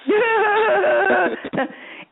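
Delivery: loud high burst of laughter; big laugh. Real, not acted. Host laughing hard at caller's tightrope joke.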